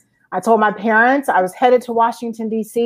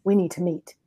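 ominous; 2 semitones lower, 6 dB quieter than usual. Retold or imitated. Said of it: "said very ominously".

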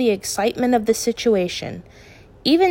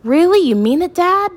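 thrilled; 11 semitones higher, 6 dB louder than usual. A narrator's reading. Son thrilled by father's offer.

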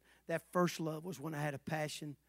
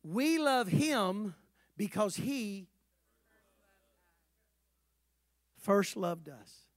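slow, drawn-out call of the verse; slow recitation; much slower than usual. Shown as a demonstration. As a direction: lead them word by word. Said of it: "you can finish this for me".

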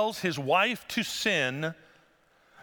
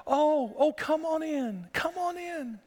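much higher, sweet coaxing hostess; sugary hostess voice; 10 semitones higher, slower than usual. Acted. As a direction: lure him in like a doting mother. Preacher voices sweet Jael.

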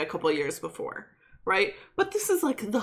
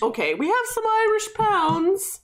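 much higher, small pleading voice, hammed up; squeaky mock plea, cap in hand; mock timid begging; 14 semitones higher than usual. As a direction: play a meek band begging for songs.

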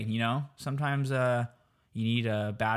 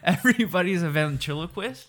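incredulous question; 5 semitones higher, 4 dB louder than usual. Real, not acted. Incredulous echo.

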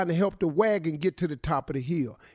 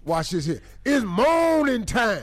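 threatening hype man; 2 semitones higher than usual. Acted. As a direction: make it sound threatening. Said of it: "Don't sound threatening".

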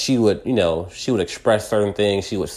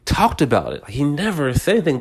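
ordinary delivery

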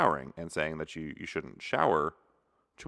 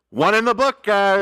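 announcer shout; 13 dB louder than usual. Acted. Announcing a mishap loudly.

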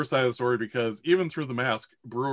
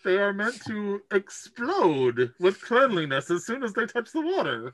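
Brewer's voice; 9 semitones higher than usual. Acted. GM holds Brewer voice.

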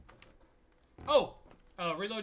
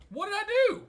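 high innocent protest; 11 dB louder, faster than usual. Real, not acted.

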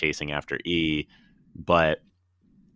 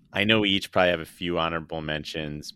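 normal talking voice

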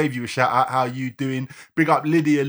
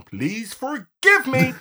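high, drawn-out comic plea; mock pleading; 10 semitones higher, slower than usual. Podcaster apologising for getting a name wrong, mock pleading. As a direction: beg playfully, stretched out and high.

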